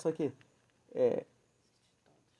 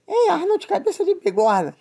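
mock cartoon sister; 12 semitones higher, slower than usual. Quoting cartoon sister teasing.

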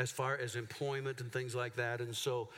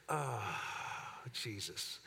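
low, soft groaning Oh Jesus; retold weary disbelief; exasperated sigh; 3 semitones lower, 5 dB quieter, much slower than usual. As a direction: sink into disbelief. Reaction to recommendation request.

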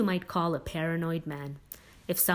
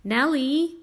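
loud, high call to his wife; raised voice, calling out; sudden yell; 8 semitones higher, 7 dB louder than usual. A narrator's reading. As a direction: holler the name through the house. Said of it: "he suddenly yelled".

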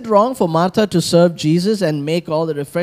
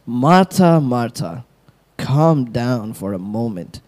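calm Jesus; 6 semitones lower, slower than usual. Acted. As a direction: gently settle her down. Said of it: "Jesus answered and said to her".

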